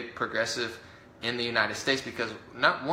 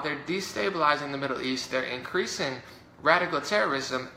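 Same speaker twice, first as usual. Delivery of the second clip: ordinary talk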